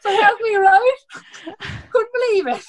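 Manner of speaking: high, loud, incredulous retelling; shocked disbelief. Retold or imitated. Friend retelling the pee request, high and incredulous. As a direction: squeal it like you still can't believe it. Reliving the shock, pitch jumps.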